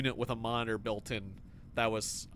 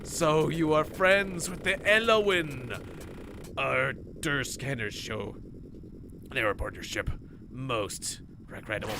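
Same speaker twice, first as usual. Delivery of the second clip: slow, formal alien envoy voice; stiff alien captain; slower than usual. Acted. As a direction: read it like a cold alien official. Alien captain reads hail reply.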